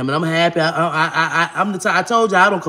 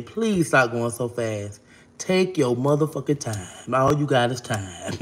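slow and much softer, dragging each word; slow plea; 6 semitones lower, 5 dB quieter, slower than usual. Acted. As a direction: ease them down like a lover begging patience. Pleading with fast lovers.

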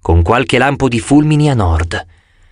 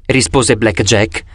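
ordinary delivery, nothing marked

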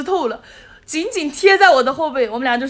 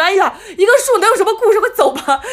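fast, louder imitated brush-off; mimicking a friend hurrying her along; impatient scolding; 6 semitones higher, 5 dB louder than usual. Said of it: "他还骂我".

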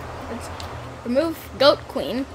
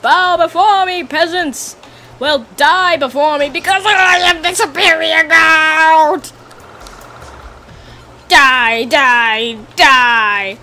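megalomaniac rant; 4 semitones higher, slower than usual. Pretend. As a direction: lord it over the peasants. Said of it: "I snap in as the almighty Goat of Power".

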